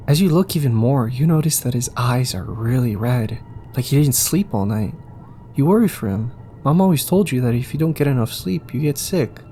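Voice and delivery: ordinary delivery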